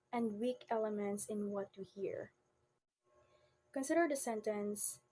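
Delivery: ordinary talk, nothing marked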